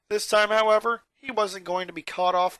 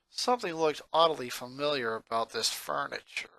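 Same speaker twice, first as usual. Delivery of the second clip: ordinary delivery, nothing marked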